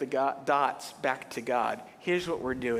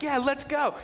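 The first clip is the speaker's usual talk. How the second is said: eager; 10 semitones higher than usual. Retold or imitated. Boy eager to play.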